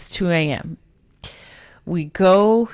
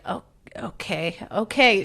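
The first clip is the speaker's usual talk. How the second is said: groggy whisper; 5 semitones higher, 4 dB quieter than usual. Retold or imitated. Sleepy friend agreeing at night.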